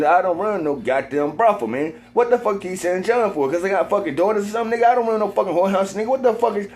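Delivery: high, fast, heated rant; rising to a furious, high-pitched protest; getting mad. Retold or imitated. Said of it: "and I start getting fucking mad".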